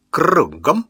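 officer ordering his wife about face; command voice. A narrator's reading. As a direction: bark it like a drill sergeant. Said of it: "командным голосом, в котором звучал металл".

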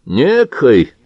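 НЕКОЙ drawn out as a challenging question; incredulous echo. A narrator's reading. Georgian throws back the vague word "Некой", incredulous and challenging. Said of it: "всплеснул руками грузин".

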